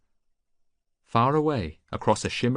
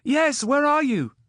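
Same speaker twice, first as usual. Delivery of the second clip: put-on much higher, louder young voice; boy shouting; 7 dB louder, slower than usual. Boy answers Odysseus, high loud shout. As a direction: cry out as a frightened boy.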